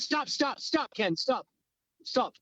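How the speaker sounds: quick repeated calls to halt, lightly acted; mock urgent interruption. Woman stopping Ken mid-attack, urgent but lightly acted.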